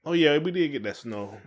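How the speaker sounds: normal talking voice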